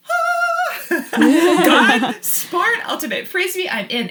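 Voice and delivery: high, loud, laughing delight; thrilled. Retold or imitated. Told about Ultimate Frisbee, she squeals with laughing delight. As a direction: squeal with excited joy, laughing.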